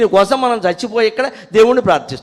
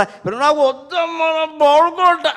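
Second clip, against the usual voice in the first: put-on higher, slow whining child voice; sleepy child; 9 semitones higher than usual. Sleepy boy refuses curd from his mother, high and whiny. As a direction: whine it like a drowsy little boy.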